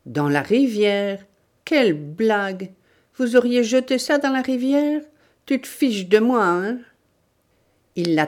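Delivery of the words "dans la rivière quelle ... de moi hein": raised, indignant mocking questions; scornful disbelief. Bandit rejecting the old woman's confession, scornful. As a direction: throw it back as an obvious lie.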